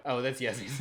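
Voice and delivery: normal talking voice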